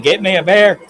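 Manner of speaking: assertive demand. Retold or imitated. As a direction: bark it like the man of the house. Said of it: "when I get assertive with my wife".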